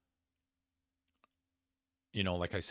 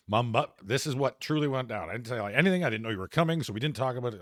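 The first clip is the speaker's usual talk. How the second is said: higher, louder insistent retelling; exasperated; 6 semitones higher, 8 dB louder, faster than usual. Retold or imitated. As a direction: press your side hard on the phone. Retold heated call back to mom.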